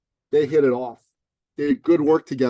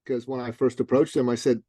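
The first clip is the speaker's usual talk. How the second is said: usual speaking voice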